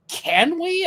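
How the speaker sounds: high, eager little question; eager surprise. Retold or imitated. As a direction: sound thrilled to be asked, mildly imitated. Colleague asked to talk about sentencing.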